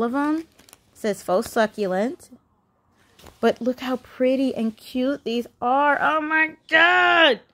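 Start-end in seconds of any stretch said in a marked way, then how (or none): 6.0-7.4 higher, very slow, drawn-out exclamation; delighted gush. Real, not acted. Vlogger swooning over cute planters, drawn-out high gasp.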